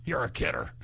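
hearty sidekick ho-ho laugh, lightly voiced; a hint of a jolly sidekick; Ed McMahon laugh. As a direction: chuckle along like a talk show sidekick. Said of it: "Johnny Carson show where you sit there".

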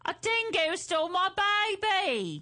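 high, theatrical Australian accent; hammed-up Australian film heroine, pitched high. Shown as a demonstration. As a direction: cry it out in a put-on Aussie accent. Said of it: "can you say that with your accent?".

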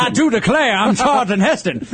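high, laughing Southern rooster voice; Foghorn Leghorn. Retold or imitated. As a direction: ham up the cartoon rooster. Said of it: "became Foghorn Leghorn".

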